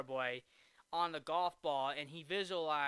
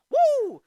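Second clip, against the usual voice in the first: very high, loud "woo"; short piercing whoop in imitation; 18 dB louder than usual. Quoting the cowboy-hatted sportscaster, loud whoop. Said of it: "who literally said".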